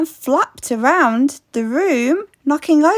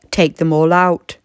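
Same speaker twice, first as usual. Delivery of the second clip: low, calm sage advice; wise old man; 7 semitones lower than usual. Acted. Wise old man advising the lady, low and calm. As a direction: speak like a patient old sage.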